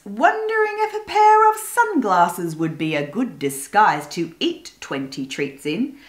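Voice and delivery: usual speaking voice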